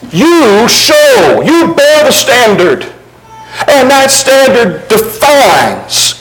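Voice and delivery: fervent preaching; preaching at full force. Acted. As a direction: drive the point home to the congregation. Preaching climax.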